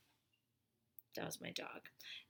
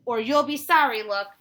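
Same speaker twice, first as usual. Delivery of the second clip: threatening look; 4 semitones higher, 12 dB louder than usual. Acted. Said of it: "giving Josh an look".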